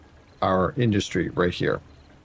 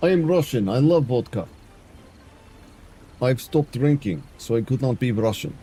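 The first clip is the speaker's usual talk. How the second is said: lightly put-on, hammy Eastern European accent; mock Eastern European accent for the chat, barely pushed; playful, half-hearted Eastern European accent; bad Eastern European accent. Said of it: "Central is wanting to hear bad Eastern European accent".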